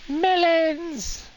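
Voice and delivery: high, loud, drawn-out shout; excited cry. Pretend. Player finding melons to top up on food, excited cry. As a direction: call it out high and long.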